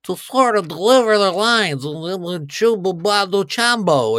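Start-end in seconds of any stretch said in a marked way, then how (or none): 2.4-4.2 mock alien gibberish.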